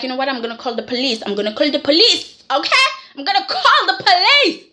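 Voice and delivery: starts at talking pitch, climbs high; mock escalating threat. Imitating a mother threatening to call police, escalating mock fury.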